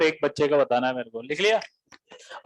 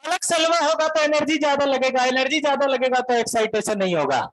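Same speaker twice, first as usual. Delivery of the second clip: much higher, heated Bihari rant; Bihari student yelling; 7 semitones higher than usual. Retold or imitated. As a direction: shout the argument at the teacher. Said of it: "काहे चिल्ला रहे इतना?". (बिहारी छात्र का चिल्लाकर बहस करना)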